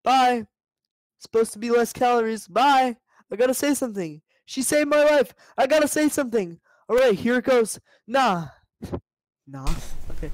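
a nervous bee psyching himself up. Acted.

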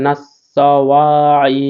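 নাসাওয়াই with each syllable drawn long; slow recitation. Shown as a demonstration. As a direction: stretch নাসাওয়াই out slowly. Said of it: "recites the word slowly". (ধীরে নাসাওয়াই)

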